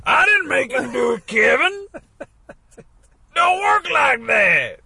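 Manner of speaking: Pacino devil. Retold or imitated. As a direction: taunt him like a grinning devil.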